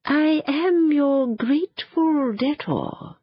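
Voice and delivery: slow, high, otherworldly bird voice; a magical bird warning them gently, drawn out; phoenix voice. A narrator's reading. The phoenix bows to Robert.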